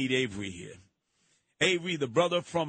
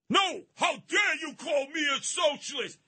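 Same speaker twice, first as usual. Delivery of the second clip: high indignant yell; outraged shout; 11 semitones higher than usual. Retold or imitated. Voicing outraged opponent.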